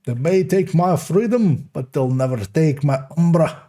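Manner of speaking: lightly hammed Scottish rallying cry; mock-heroic Scottish battle speech; Scottish accent. Gamer parodying a Scottish war cry in mock accent. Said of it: "I apologize to the entirety of Scotland".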